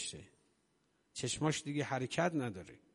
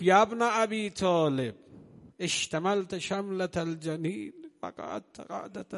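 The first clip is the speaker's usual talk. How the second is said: loud, higher reproach cried out at a grieving husband; rebuking shout; 4 semitones higher, 6 dB louder, slower than usual. Retold or imitated. Zahra rebukes Ali to rise from grief, a loud shout. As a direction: cry it out like a wife shaking him awake. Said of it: "یه فریاد کشید سر امیرالمومنین".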